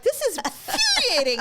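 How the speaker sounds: high, loud furious yell at the game; retold angry outburst. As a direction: vent it like a wet hen. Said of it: "Why am I so angry?".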